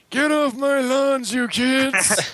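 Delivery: put-on high, slow grumpy old man; old prospector. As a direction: croak it like a cranky old-timer. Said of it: "we're like".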